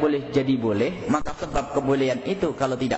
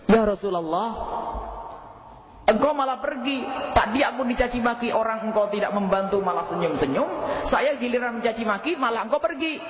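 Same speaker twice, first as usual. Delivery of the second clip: higher, complaining voice of the wronged Abu Bakar; aggrieved companion; 6 semitones higher than usual. Retold or imitated. Abu Bakar protesting to the Prophet, aggrieved higher complaint. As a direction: plead and protest to the Prophet, hurt and puzzled.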